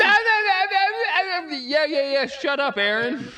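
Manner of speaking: loud, high-pitched squawk of protest; high yelled protest, half in play; playful outburst. Real, not acted. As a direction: shout down a teasing friend. Shouts down a co-player's teasing.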